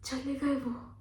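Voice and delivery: usual speaking voice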